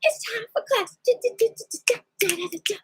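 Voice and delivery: high, sing-song announcement to a cat; high playful call, trailing into da-da-das; excited mimicry. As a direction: re-enact it in a high, playful chirp. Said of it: "And I went like this".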